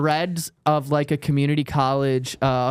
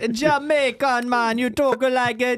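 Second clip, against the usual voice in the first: high, drawn-out fake Jamaican accent; bad Jamaican accent; 6 semitones higher than usual. Host showing co-host the accent, bad Jamaican accent. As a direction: attempt a hammed-up Jamaican accent. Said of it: "I can't do the Jamaican accent".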